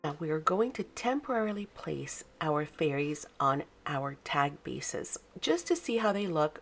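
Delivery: usual speaking voice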